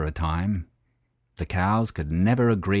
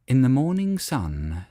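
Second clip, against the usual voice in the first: plain reading voice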